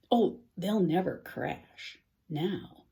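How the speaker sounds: ordinary delivery, nothing marked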